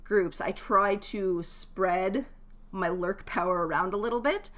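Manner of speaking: normal talking voice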